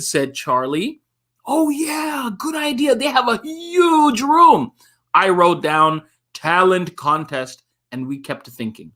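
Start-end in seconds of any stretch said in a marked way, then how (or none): 1.5-4.7 put-on excited kid. Eager kid character reply.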